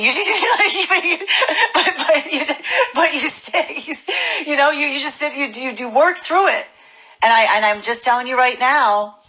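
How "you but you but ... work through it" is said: laughing high. Real, not acted. Laughing high through advice.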